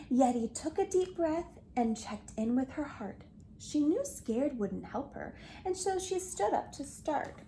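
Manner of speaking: usual speaking voice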